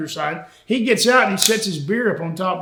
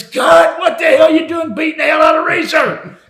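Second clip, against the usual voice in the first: yelling cut, high and loud, half laughing; angry shout; 9 semitones higher, 6 dB louder than usual. Retold or imitated. A boss yells at the wrestlers who knocked the announcer over, loud and high. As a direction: bellow it like a furious director. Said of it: "Watch screams".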